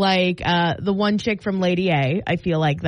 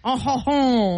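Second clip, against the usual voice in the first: put-on French accent; slower than usual. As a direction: play a mock French "oh ho ho". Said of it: "What about French?".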